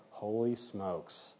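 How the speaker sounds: hushed, drawn-out retold amazement; stunned disbelief. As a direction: stretch it out quietly, as if stunned. Retells colleagues' stunned reaction to evidence.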